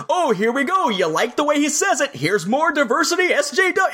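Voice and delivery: mocking jab. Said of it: "the other side jumps in".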